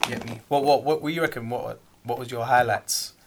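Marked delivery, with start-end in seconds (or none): none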